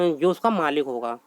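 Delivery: ordinary talk, nothing marked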